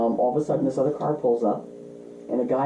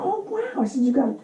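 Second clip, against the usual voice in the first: retold wide-eyed wonder, pitched way up; impressed surprise. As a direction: act out being impressed, high and marvelling. Retelling own impressed reaction.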